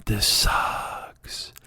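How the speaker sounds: unvoiced grumble under breath; bored George whispering alone on watch; whispered. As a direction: mutter it to yourself in the dark.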